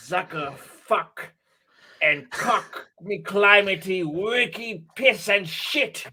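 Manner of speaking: slow hammy fake French; mock French gibberish. Mocking the Merovingian for a friend, fake French gibberish. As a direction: babble fake French slowly. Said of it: "He says".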